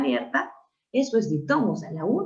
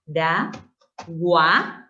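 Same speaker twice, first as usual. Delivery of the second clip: Pa, da, gua with a pause after each; syllable by syllable; 2 semitones lower, much slower than usual. Shown as a demonstration. Teacher showing how paraguayo divides, syllable by syllable. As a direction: separate Pa, da, gua, ending gua without the Y. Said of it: "la separación es de esta forma".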